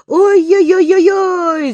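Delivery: high, loud wail losing balance; frightened cry. Acted. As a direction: cry out in panic, slow. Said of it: "закричал он". (испуганный крик, высоко и громко)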